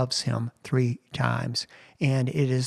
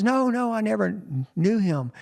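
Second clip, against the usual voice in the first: higher, pressed no no; a touch of desperate insisting; insistent denial; 7 semitones higher than usual. Retold or imitated. Peter denies a third time, higher and insistent.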